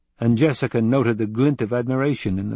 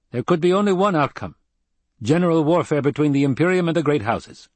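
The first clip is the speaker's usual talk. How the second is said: higher, fast urgent warning; stern planetologist; 7 semitones higher, 4 dB louder, faster than usual. A narrator's reading. Kyne warning Paul of general warfare, urgent. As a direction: press the danger quickly.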